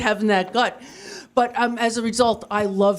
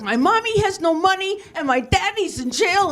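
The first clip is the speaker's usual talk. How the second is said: put-on child voice, higher; 10 semitones higher than usual. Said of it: "She's like".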